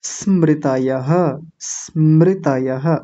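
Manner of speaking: स्मृतयः slowly, pure s and final ha; careful model pronunciation. Shown as a demonstration. Teacher showing the changed word, slow स्मृतयः. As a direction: draw out स्मृतयः, sounding the visarga. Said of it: "क्या है?".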